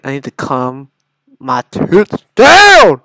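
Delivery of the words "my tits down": slow, loud, rising exclamation; worked-up yell. Real, not acted. Gamer failing to calm himself, worked-up yell. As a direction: try to calm down by yelling.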